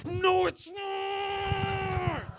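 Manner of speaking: high, slow, stretched-out shout of denial; outraged shout. Retold or imitated. Reader shouting at a book in outrage. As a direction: yell it back at the book, drawn out. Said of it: "I read that, and I was like".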